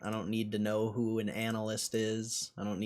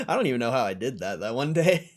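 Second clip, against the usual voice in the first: ordinary talk, nothing marked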